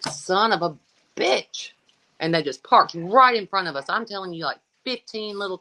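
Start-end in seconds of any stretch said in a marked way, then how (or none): 0.0-1.7 exasperated curse. Retold or imitated. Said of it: "I was like".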